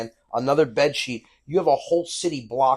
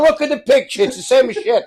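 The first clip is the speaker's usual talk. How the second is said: much higher, louder mock dad; gruff dismissive father; 10 semitones higher, 9 dB louder than usual. Father brushing off son's wish to see Venice, loud mock. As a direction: brush the kid off impatiently.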